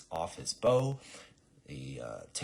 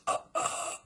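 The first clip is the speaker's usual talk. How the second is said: loud broken "uh" noises; shocked stammer; 4 dB louder than usual. Acted. Assassin after arrow hits clipboard, shocked wordless stammering. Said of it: "He's all shocked".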